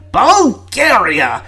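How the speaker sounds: Bulgaria! drawn out slowly in disbelief; exasperated. Acted. Prime Minister scoffing at Bulgaria, slow exasperated stress. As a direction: stretch Bulgaria! out, scandalised.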